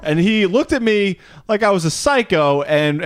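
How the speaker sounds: normal talking voice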